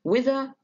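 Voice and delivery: "with her" high and light, no accent; both words of "with her" left unstressed, pitch up; unstressed ending. Shown as a demonstration. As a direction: let "with her" ride high at the end of a question. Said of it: "في الآخر مش واخدين ستريس".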